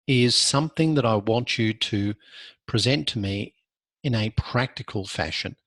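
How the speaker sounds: ordinary talk, nothing marked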